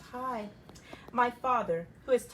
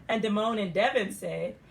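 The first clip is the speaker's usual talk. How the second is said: ordinary delivery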